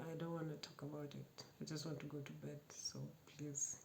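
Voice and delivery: quiet, quick and curt, shutting him out; a hushed, sulky refusal to talk; cold, fake-angry brush-off. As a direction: mutter it fast like you're still furious. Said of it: "acted like I was still angry".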